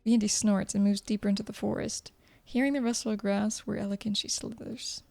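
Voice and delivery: usual speaking voice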